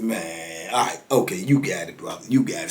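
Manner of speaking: low, soft concession; retold weary surrender; resigned giving in. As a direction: back down quietly, voice dropped. Barber gives in to angry customer.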